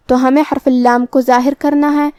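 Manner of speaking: ordinary delivery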